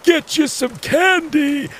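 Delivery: put-on high, slow crazed old man voice; crazy old man. A narrator's reading. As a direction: taunt like a mad old man. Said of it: "Mister Hinkle yelled and laughed".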